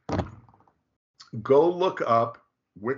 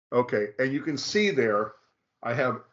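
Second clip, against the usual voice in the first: ordinary talk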